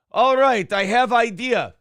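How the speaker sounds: put-on high, broken-English Russian accent. Prigozhin rallying his men, in a hammed-up Russian accent. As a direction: play a cocky Russian mercenary. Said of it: "Teddy KGB impressions".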